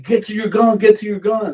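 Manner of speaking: high, loud repeated command; urgent retold order. Soldier ordering men to guns under fire, imitated urgent shout. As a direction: imitate a shouted call to arms.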